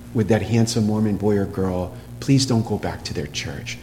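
low, soft parental plea; tender, hushed correction from a parent; mild, gentle. Acted. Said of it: "Mildness, in my opinion".

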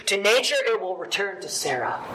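ordinary talk, nothing marked

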